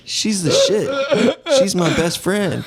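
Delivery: high-pitched, slow stoner musing; stoned kid. Retold or imitated. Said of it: "you ever be really high".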